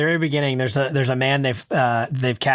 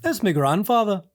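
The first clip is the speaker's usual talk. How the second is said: 1 Paul; 7 semitones higher than usual. Retold or imitated. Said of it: "And Paul's like".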